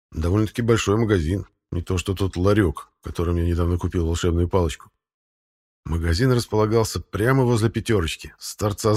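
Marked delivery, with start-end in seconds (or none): none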